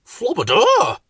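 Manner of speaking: high nonsense exclamation; silly startled flowerpot-man voice; alarmed Bill. A narrator's reading. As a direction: recoil with a squeaky word. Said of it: "who thought it was a spider".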